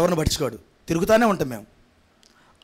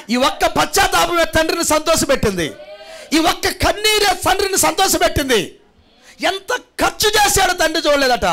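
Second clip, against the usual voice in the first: full-force preaching; 10 semitones higher than usual. Acted. Pastor rousing the congregation, building to a shouting preach.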